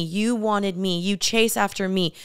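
usual speaking voice

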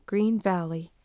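usual speaking voice

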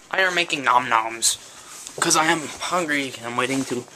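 ordinary talk

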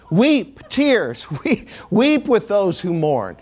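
reads weep while laughing; can't keep a straight face on weep; laughing while reading. Real, not acted. Laughing through the word weep.

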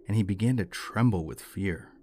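usual speaking voice

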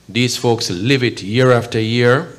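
ordinary delivery

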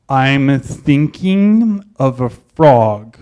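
slow, drawn-out teacher voice from the shadows; mysterious teacher. Retold or imitated. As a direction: play the cryptic teacher, each word slow. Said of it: "he's all mysterious".

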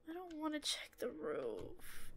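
very soft, small, hushed voice; scared reluctance. Real, not acted. Player dreading the climb to the roof, hushed reluctance. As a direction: shrink to a near whisper.

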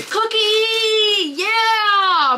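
high, loud squeal of delight; excited. Real, not acted. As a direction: squeal over the treat. Delighted reaction to cookie.

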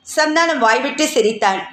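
plain reading voice

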